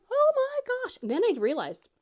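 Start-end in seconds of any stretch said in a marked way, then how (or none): 0.1-1.0 high-pitched retold shriek; mock shriek. Mom re-enacting her zip line scream, shrieking. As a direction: shriek it in a high voice, not at full force. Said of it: "I was shrieking".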